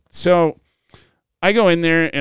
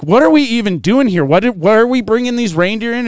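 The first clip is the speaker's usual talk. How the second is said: higher, louder mock indignation; retold indignant complaint; 9 semitones higher, 5 dB louder than usual. Handler's wife questioning the shoot, imitated indignation. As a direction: demand answers like a fed-up wife.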